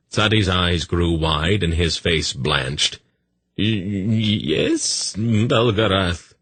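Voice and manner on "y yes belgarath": higher, slow, frightened stammer; a frightened eunuch stammering yes; stammering fearfully. A narrator's reading. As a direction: stumble over the yes, scared. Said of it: "he stammered fearfully".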